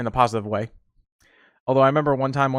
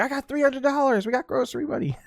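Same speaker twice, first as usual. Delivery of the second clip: lightly high grandma bragging; a cheerful hint of grandma's win; 12 semitones higher than usual. Grandma back from gambling, lightly voiced and happy. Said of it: "be like".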